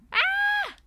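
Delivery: a joyful cartoon yelp; high excited shriek. Player voicing Greg's happy scream, high shriek. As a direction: squeal it high and loud. Character yelling in dialogue.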